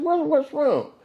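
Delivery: worried question, pitched well up; a startled son asking what's wrong; alarmed. Retold or imitated. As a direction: son alarmed at his crying mother, high startled ask. Alarmed at crying mother.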